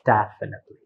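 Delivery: "Definitely" with DEF pitched highest; high tone on first syllable. Shown as a demonstration. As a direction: start "Definitely" high, then fall away. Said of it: "you have the high tones on this".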